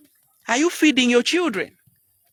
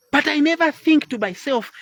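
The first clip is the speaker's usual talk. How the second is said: usual speaking voice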